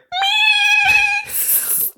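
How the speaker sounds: very high, long kettle squeal; tea kettle whistle. Acted. Stressed parent boiling over, whistling like a kettle. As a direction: shriek like a kettle coming to the boil. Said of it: "it's like a tea kettle".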